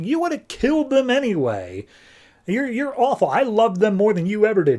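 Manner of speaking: high-pitched retort, volume kept normal; mock indignant retort. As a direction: play-act a high, wounded comeback in a quarrel. Acted quarrel, throwing accusations back.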